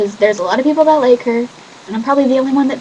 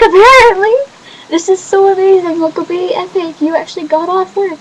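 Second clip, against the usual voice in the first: much higher, loud excited squeal; overjoyed; 10 semitones higher, 5 dB louder than usual. Retold or imitated. Telling her friend she gets to see her brother, overjoyed and high. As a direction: burst out with joy.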